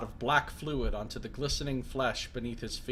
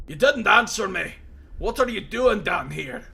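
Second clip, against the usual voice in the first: loud, raised voice of a wounded prisoner; gruff Mazov; 8 semitones higher, 8 dB louder than usual. Acted. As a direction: bark it at the intruder in surprise. Mazov presses again.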